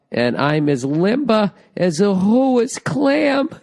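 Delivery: reading a listener's silly line aloud, slow and mock-grand. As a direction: savour the absurd simile.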